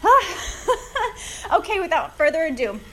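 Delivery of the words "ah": laugh. Real, not acted. Laughs at being winded.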